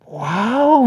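impressed wow. Retold or imitated. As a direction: say "Guau" like you're impressed. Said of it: "Ya parecía plática de perros". ("Guau" asombrado, más agudo)

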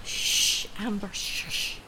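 whispered shh, then a soft hush; hushed shushing. Acted. Mistress hushing her maid in captivity, soft and urgent. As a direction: keep her quiet so the captor won't hear.

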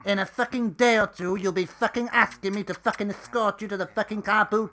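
loud, high rant, every swear word spat out; furious swearing. Acted. Edith screams abuse back at Maggie, furious. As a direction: tear into her at full volume.

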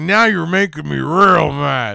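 loud, hammed-up mad villain; mock-menacing outburst; mock anger. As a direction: act out the villain getting real mad, loudly.

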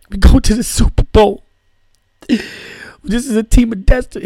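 mock victory speech pitched high and loud; Ray Lewis impression. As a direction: play a tearful, overexcited linebacker at a press conference. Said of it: "It's a, it's a little much, Ray".

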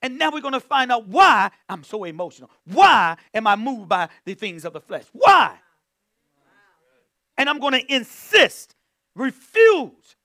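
building to a loud high cry; climactic preaching. Acted. Preacher crying out to the church about the flesh, building loud preaching. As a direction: climb toward the shout on why.

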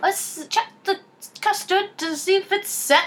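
high, dainty baker checking custard; sing-song it like a gentle contestant; sweet baker voice. Pretend. Sister playing a polite British baker, high and dainty.

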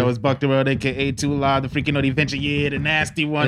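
hype intro. Acted. Host introducing himself to listeners, hyped. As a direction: announce yourself to the crowd with swagger.

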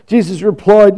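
normal talking voice

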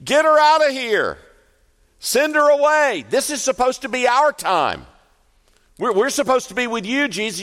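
high, loud, pushy complaining; high-pitched irritated followers shooing her off; impatient disciples. Acted. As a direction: mimic fussy disciples wanting her gone. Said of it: "his disciples start saying".

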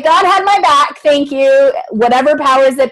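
jubilant thanks. Retold or imitated. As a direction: cry out thanks to the heavens. Exclaiming thanks to the universe.

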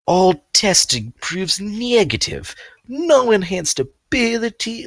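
high, slow imitation of Dexter's Middle European accent; a stilted Middle European Dexter voice; attempted Dexter accent. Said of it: "I can't do Dexter's accent".

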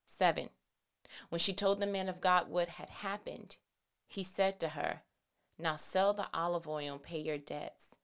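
low, soft Bible reading voice; scripture reading. Acted. Preacher reads Second Kings to the church, low scripture reading. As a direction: read the passage quietly and evenly.

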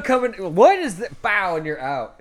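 ordinary delivery

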